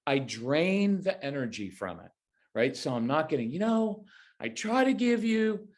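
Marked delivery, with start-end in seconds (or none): 3.5-5.7 high, nagging lecture voice; mock a parent starting a lecture; lightly hammed-up lecturing parent. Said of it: "there's no lecturing".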